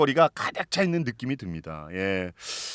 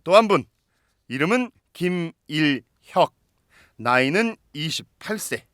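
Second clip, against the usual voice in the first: announcer voice; 4 semitones higher, 4 dB louder than usual. Acted. Host introduces a guest.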